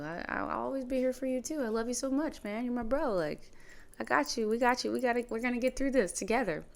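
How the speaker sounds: high, earnest reassurance to his brother; reassuring his dying brother, eager and high. Retold or imitated. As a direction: lift the voice to cheer him up. Comforting his brother in hospital.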